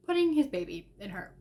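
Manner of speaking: normal talking voice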